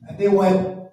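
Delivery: ordinary talk, nothing marked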